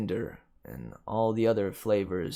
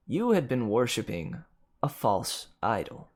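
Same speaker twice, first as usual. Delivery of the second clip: ordinary delivery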